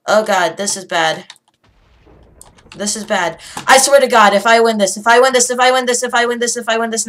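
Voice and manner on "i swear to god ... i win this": loud, high burst of rapid repeats; frantic excitement. Real, not acted. Gamer close to winning, frantic excitement. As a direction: blurt it over and over, worked up.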